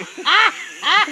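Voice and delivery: short squeal of laughter; high laugh. Real, not acted. Laugh during retelling.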